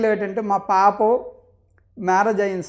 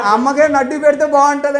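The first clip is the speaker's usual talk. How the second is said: laughing through quote; 5 semitones higher, 5 dB louder than usual. Retold or imitated. Daughter's nickname joke, laughing.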